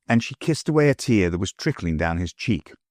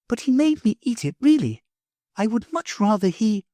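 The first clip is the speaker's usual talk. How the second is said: put-on much higher sweet girl voice; a little girl hurrying off; high child's voice; little girl Sylvie; 11 semitones higher than usual. Sylvie chatting on the walk.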